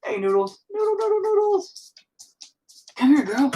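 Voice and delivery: high sing-song call to the dog; pet voice. Real, not acted. Calling the dog in a high pet voice. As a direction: coo the dog's name up high.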